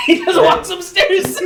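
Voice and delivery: high laughter over words; laughing hard. Real, not acted. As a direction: crack up while telling it.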